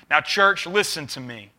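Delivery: ordinary talk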